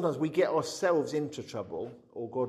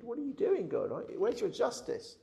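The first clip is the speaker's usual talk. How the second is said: much higher, exasperated plea; indignant protest; 9 semitones higher than usual. Retold or imitated. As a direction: demand justice from God. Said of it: "Abraham interceding".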